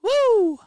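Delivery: loud high whoop of celebration; real excited woo. Real, not acted. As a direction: let out a joyful whoop. Celebrating the raid arriving.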